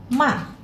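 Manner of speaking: ma falling fast; fourth tone ma. Shown as a demonstration. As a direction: drop ma quickly. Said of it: "turun saja agak cepat".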